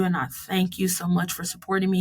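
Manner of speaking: usual speaking voice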